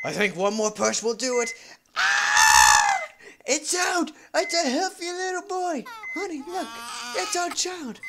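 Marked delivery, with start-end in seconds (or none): 2.0-3.0 high loud acted cry of pain; hammed-up labour scream; birth scream. 3.4-8.0 higher excited voice; lightly voiced delighted husband; acted joyful voices. Voicing doctor and husband.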